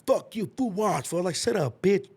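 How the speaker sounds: high, heated trash talk; mock angry insults. Two fighters trading insults after the fight, heated. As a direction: spit the insults back.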